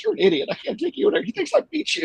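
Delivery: high, hammed-up scolding wife; mock angry wife. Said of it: "I acted like she was mad".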